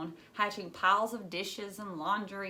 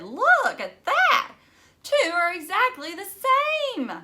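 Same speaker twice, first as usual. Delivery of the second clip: much higher and louder, amazed and thrilled; excited exclaim; 12 semitones higher, 9 dB louder, slower than usual. Acted. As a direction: point it out to the whole class, stretched out and amazed. Classmate excited over matching drawings.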